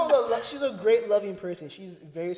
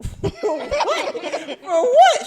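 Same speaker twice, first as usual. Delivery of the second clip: loud, very high-pitched disbelief; hammed-up baffled protest; retold incredulity; 11 dB louder, much slower than usual. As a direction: squawk it in mock outrage. Retelling disbelief at money demand.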